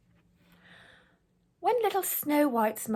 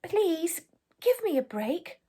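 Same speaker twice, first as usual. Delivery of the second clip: pleading; 8 semitones higher than usual. A narrator's reading. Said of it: "She cried".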